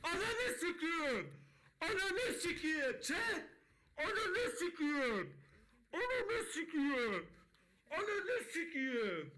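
high, drawn-out yelling with laughter; ecstatic scream. Real, not acted. Streamer screaming to chat over a huge slot win, ecstatic.

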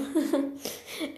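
quiet little giggle; soft giggle. Pretend. Warrior cat giggling at her own mouse joke to Alex, soft giggle.

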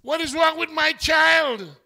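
put-on God scolding a doubting child; exasperated father. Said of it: "I said".

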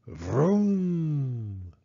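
long drawn-out engine noise; play-car engine sound; toy car vroom. A narrator's reading. Wheeling a toy car around.

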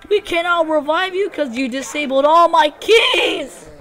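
loud, high-pitched rant; exasperated shouting. Real, not acted. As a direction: shout the accusation, building to the end.

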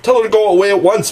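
high, loud, quick outcry; urgent cry. A narrator's reading. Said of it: "the three cried simultaneously".

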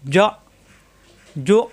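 "জ" and "জো" cut off by glottal stops; "জ", "জো" stopped short; caught in throat. Shown as a demonstration. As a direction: lock "জ" and "জো" in the throat. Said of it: "গলার ভিতরে আটকে যাবে".

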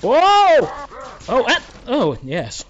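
loud high whoop; excited shout. Real, not acted. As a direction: cheer at the close call. Winning a tense fight.

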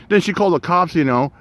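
ordinary talk, nothing marked

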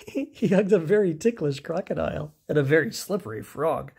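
ordinary delivery, nothing marked

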